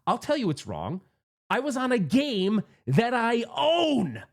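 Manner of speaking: high, slow, drawn-out mock outrage; exaggerated ranting. Sore loser ranting at his friend, theatrically outraged. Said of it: "And I'm talking like this".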